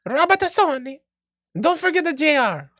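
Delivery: high little Mario voice; comic plumber-style impression; Robert Tassoni. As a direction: announce it in squeaky cartoon falsetto. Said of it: "Robert Tassoni with that little Mario".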